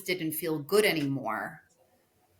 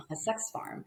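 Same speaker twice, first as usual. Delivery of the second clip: whispered; 5 semitones higher, 8 dB quieter than usual. Real, not acted. To the podcast host, whispering the shocking phrase a sex farm. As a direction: whisper a sex farm under the breath, voiceless and soft. Repeating taboo phrase under breath.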